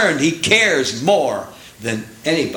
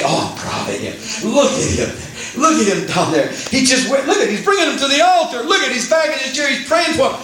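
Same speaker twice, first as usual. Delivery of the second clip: much higher, gleeful and breathless with laughter; delighted proud Father; 11 semitones higher than usual. Retold or imitated. Preacher voicing God delighting in a believer at the altar, gleeful high gushing. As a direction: gush like a thrilled parent pointing at his child. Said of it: "Don't you say".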